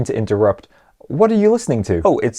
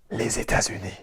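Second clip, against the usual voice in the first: whispered Les États-Unis; hushed Les États-Unis; 5 semitones lower, 13 dB quieter, much faster than usual. Shown as a demonstration. Repeated drill, varied takes.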